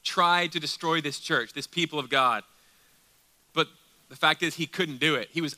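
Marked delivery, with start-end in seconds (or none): 0.0-1.5 "Tried" pushed up in front, then falling; stress on TRIED. Shown as a demonstration. Preacher repeats Paul's failed attack for the congregation, stressing "Tried". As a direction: lean hard on the first word, "Tried". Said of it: "Emphasis on tried".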